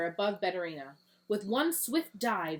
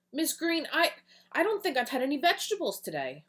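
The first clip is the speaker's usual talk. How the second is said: sheepish hero; 6 semitones higher than usual. Acted. Hero admits no vegetables.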